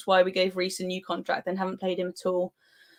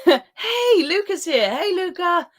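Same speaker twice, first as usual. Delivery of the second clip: delighted greeting; 9 semitones higher, 4 dB louder, slower than usual. Real, not acted. A friend joins the stream.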